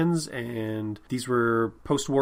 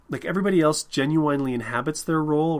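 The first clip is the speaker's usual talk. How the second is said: ordinary talk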